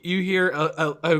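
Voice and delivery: ordinary delivery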